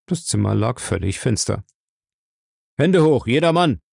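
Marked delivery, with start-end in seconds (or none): 2.8-3.8 loud, high commanding shout. A narrator's reading. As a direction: bark it into the dark room. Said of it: "schrie er in das plötzliche Dunkel".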